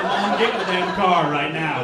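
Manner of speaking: loud, scolding mock order; mild mock yell at mom; embarrassed son. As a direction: hurry mom away in embarrassment. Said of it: "I was like".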